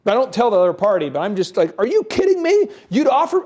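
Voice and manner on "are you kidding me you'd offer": high, loud indignant disbelief; mock-furious outburst. Negotiator venting in his Hummer, mock-furious outburst. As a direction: blow up as if venting alone. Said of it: "I am mad".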